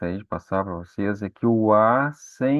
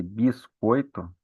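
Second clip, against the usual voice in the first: biscoito said slowly, syllable by syllable; teacher spelling out biscoito slowly; slow syllable demo; much slower than usual. Shown as a demonstration. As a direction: stretch out biscoito slowly for the class. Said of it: "Na primeira sílaba".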